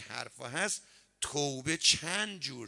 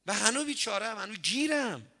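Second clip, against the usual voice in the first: pleading father; 8 semitones higher, 6 dB louder than usual. Retold or imitated. Father complains in the dream.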